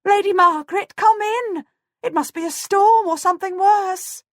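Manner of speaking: high, loud alarmed pleading; frightened calling. A narrator's reading. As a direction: call her in from the storm in fear. Servant calling again in fear.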